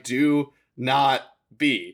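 each word of "Do not be" slow and separate; firm, very slow command on "Do not be"; emphatic insistence. Retold or imitated. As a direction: stretch out "Do not be", every word weighted. Acted reply to an apology.

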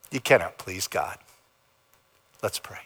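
normal talking voice

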